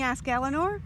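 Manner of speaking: ordinary delivery, nothing marked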